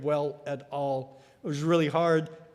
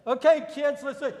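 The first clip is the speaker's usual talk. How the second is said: put-on calling the kids; 8 semitones higher than usual. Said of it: "I say".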